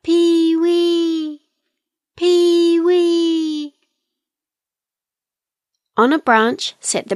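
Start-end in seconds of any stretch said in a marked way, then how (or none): 0.0-3.7 high, long-held bird call; distant kiwis calling. A narrator's reading. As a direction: stretch out "Pee-wee" high and even. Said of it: "the other kiwi birds called out".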